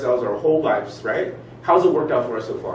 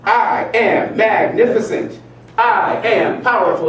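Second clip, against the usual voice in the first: louder, strong declaration; proud affirmation; 8 semitones higher, 5 dB louder, slower than usual. Shown as a demonstration. Leading the group affirmation, proud and loud. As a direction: say it like you own it.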